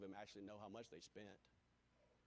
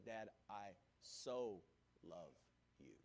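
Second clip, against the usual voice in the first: put-on slow, softer, hushed child's declaration; a touch of a child's tender confession; tender child's whisper-soft love; 4 semitones lower, 3 dB quieter, slower than usual. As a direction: say it slowly and gently, like a small boy holding his dad's face. Child holds dad's face, tells love.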